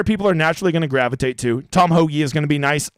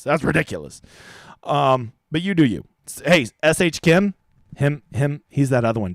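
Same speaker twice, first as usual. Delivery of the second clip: normal talking voice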